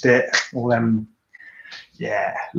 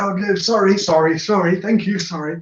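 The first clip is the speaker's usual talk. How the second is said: higher, louder string of sorries; flustered mock apologies, higher; 8 semitones higher, 4 dB louder than usual. Cyclist apologising to riders while passing, higher. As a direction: babble apologies as you squeeze past.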